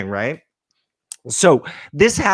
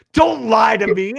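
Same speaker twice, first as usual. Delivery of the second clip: loud, higher mock scolding; retold angry demand; 5 semitones higher, 3 dB louder than usual. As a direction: imitate an accusing shout. Jealous girl accuses him.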